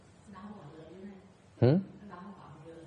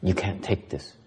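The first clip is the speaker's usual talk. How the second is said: ghost's whisper; 4 semitones lower, 9 dB quieter than usual. Acted. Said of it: "the whisper in your ear, it says".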